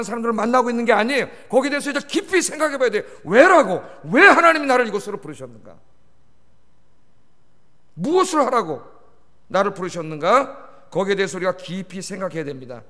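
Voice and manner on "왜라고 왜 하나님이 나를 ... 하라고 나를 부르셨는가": urgent preaching. Real, not acted. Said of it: "preacher challenging congregation".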